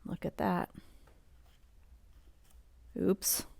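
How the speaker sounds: usual speaking voice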